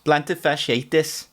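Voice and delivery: slow Geordie "Plantar fasciitis" with a rise; Geordie accent. Shown as a demonstration. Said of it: "best word to say in a Geordie accent".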